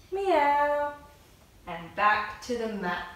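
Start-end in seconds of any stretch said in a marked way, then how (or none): 0.1-0.9 put-on high playful meow for the kids; cat meow. Shown as a demonstration. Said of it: "make the cat noise".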